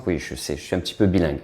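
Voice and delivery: usual speaking voice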